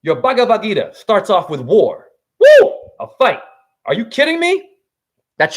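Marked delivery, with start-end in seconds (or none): 2.4-2.7 loud high whoop; excited whoop. Real, not acted. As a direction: cheer it like a fan. Cheering that the Gita starts with war.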